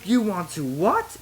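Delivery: Obi-Wan hearing the marriage plan, crying out in shock. A narrator's reading. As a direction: blurt it out in shock. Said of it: "Obi-Wan cried".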